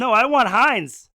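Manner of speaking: slow, loud and emphatic; loud mock insistence. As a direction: stretch it out and demand it loudly. Jokingly insisting on Heinz.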